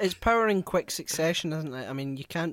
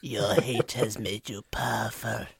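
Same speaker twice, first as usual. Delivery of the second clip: low, slow villain impression; Palpatine; 8 semitones lower, slower than usual. Podcaster imitating the Emperor for his friends, low sinister drawl. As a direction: intone it deep and unhurried like a Sith lord. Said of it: "Palpatine impression".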